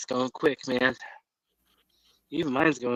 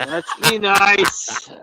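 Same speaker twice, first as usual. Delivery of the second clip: high, loud, slow mock whine; fake-sob the plea; mock crying; 8 semitones higher, 8 dB louder, much slower than usual.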